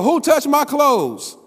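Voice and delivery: high, slow, loud yell; Jesus yelling. Acted. Jesus yells to find who touched him, slow and loud. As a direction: yell it over a multitude. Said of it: "And Jesus had to yell".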